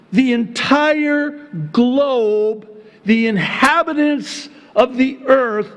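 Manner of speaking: high, drawn-out proclamation; emphatic preaching. Real, not acted. Preacher hammering home the whole world.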